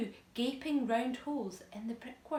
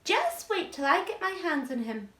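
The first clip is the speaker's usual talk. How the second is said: menacing aunt voice; 7 semitones higher, 7 dB louder than usual. Acted. Aunt threatening the boy.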